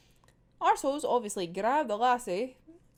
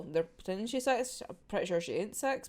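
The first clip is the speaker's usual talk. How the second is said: ordinary talk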